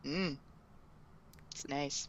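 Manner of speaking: very quiet, low murmur with pauses; awkward small talk. Retold or imitated. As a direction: imitate a stilted, hushed compliment. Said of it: "just awkward little them".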